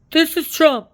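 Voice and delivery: high, loud mock Trump announcing himself; pompous Trump parody voice. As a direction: bark it like a cartoon Trump. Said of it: "I can't even do the Trump voice".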